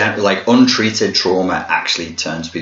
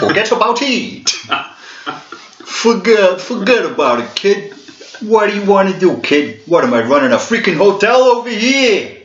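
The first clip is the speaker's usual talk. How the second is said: much higher, louder posh try at a tough gangster; an English toff badly faking a mobster; Hugh Grant failing at gangster; 12 semitones higher, 3 dB louder than usual. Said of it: "Hugh Grant sat there going".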